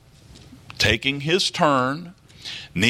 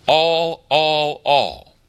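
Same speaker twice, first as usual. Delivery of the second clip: All, all, all, each punched high and loud, then easing down; hammering the word all; 3 semitones higher, 4 dB louder, much slower than usual. Shown as a demonstration. Preacher to the congregation, pounding All, All, All. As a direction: hit all three times, stepping down. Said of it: "overabundance use of the word all".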